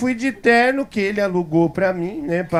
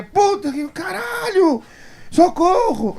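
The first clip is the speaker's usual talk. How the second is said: much higher, slow, strained cry for help; mock panicked scream; 10 semitones higher, slower than usual. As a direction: imitate a terrified woman screaming. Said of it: "eu ouço uma gritaria".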